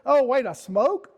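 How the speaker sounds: high, drawn-out mock realization; mock oblivious person. Vlogger playing a clueless smoker with sore joints, mock obliviousness. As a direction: play someone cluelessly noticing their bad habits, slow and high.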